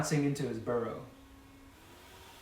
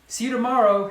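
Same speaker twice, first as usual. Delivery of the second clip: higher, louder call across the grass; shouted; 8 semitones higher, 6 dB louder, faster than usual. A narrator's reading. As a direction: call out a cheerful goodbye. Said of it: "he shouted".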